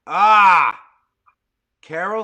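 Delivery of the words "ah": very loud, high "ah"; sudden shout of discovery; excited exclamation. Real, not acted. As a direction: burst out as the answer lands. Answer arrives from listener.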